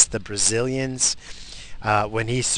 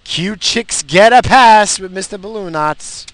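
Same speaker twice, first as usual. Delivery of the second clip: higher, louder, slowed put-on voice; mock funny voice; 8 semitones higher, 10 dB louder than usual. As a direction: ham it up like a cartoon narrator. Joking aside while driving.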